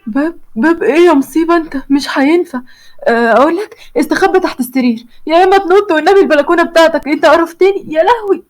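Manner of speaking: high, flustered panic; frantic. Acted. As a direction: wring your hands in alarm.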